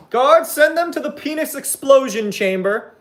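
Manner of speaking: high, imperious royal command; evil queen. Pretend. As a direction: summon the guards like a tyrant queen. Cult leader sentencing someone.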